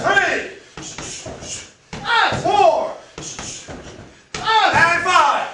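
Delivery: loud, high grunting shouts with each strike; hitting a punching bag. Real, not acted. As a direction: belt out each count mid-punch. Striking while counting, kiai shouts.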